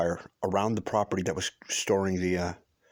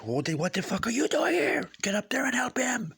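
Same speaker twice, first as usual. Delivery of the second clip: hushed, high-pitched mock yell; bawling out. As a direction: imitate an angry boss's tirade in a strained whisper-shout. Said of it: "He starts bawling them out".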